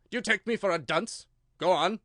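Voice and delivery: a king scolding his subject; loud reproving royal voice; stern king. A narrator's reading. King sharp.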